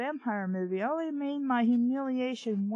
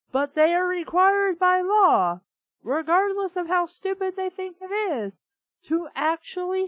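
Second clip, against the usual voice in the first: rising, loud rant about the law; loud, higher exasperated lecture; 7 semitones higher, 5 dB louder than usual. Real, not acted. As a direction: hammer the point home, louder and higher. Ranting about false reports.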